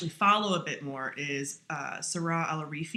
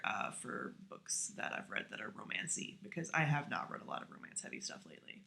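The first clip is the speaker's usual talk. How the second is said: whisper; 3 semitones lower, 10 dB quieter than usual. Real, not acted. Drops to a whisper.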